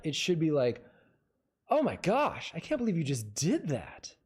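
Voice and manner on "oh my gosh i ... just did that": high, swooping 'oh my gosh', astonished; varied take one. Shown as a demonstration. Acting coach demonstrating a first take, exclaiming. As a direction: exclaim it with big pitch jumps. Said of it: "That was three different ways I just delivered that line".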